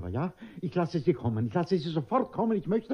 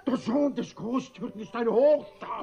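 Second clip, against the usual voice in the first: scoffing; 8 semitones higher, 5 dB louder than usual. Acted. Father scoffs at daughter.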